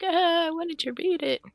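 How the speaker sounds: high whiny sulk; whiny disappointment. Retold or imitated. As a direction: whine it like a let-down kid. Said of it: "And I'm like".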